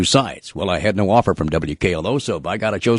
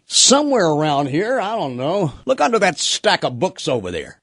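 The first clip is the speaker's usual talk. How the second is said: higher-pitched offhand impression; light, higher put-on nonchalance; Johnny; 8 semitones higher than usual. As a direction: play an unbothered boss, pitched up. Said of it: "And Johnny's like".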